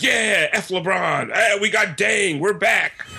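high, shouted mock celebration; defiant fan cheer. Imitating fans cheering their team's new signing, high and defiant. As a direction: crow it like a fired-up fan. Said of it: "he's so defiant, and you're like".

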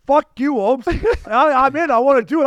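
high-pitched mock-indignant outburst; retold yelling match. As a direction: act out swearing back at a friend. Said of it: "I'm yelling at each other".

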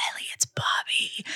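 eerie whispered voice through a monitor; hushed spooky whisper; creepy whisper. Retold or imitated. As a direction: breathe it like a creepy stranger. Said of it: "is like".

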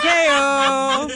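high, loud, drawn-out holler; mock gag reveal. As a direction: belt it out like a punchline reveal. Acts a suggested gag reveal.

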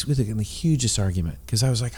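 usual speaking voice

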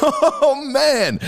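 ordinary delivery

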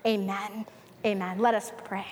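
usual speaking voice

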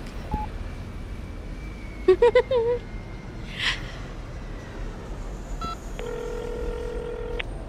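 long, high, breathy squeal of glee; giddy squeal. Acted. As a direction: let out a long excited squeal. Excited after the phone call.

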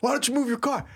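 high-pitched requote at ordinary volume; mock irate priest. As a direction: echo the yell without the volume. Repeats the priest's line more quietly.

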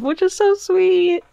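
high, drawn-out "aww" delivery; gushing coo. Real, not acted. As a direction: coo it slowly, high-pitched. Cooing over the reunion.

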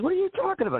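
lightly voiced wife, indignant; imitating the wife. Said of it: "And she goes".

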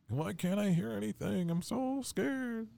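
high, slow mock scared whimper. Shown as a demonstration. Showing co-host how he sounds when scared, high and slow. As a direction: play-act a scared little voice. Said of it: "That's the way I sound".

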